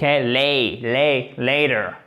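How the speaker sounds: "Lay, lay" alone, then "later" with a D-like T; syllable build-up demo. Shown as a demonstration. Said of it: "Le T devient presque un D".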